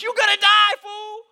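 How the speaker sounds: very high, loud shriek of warning; exasperated yell. Retold or imitated. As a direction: scream it at them, hammed-up and exasperated. Retold furious warning to defiant students.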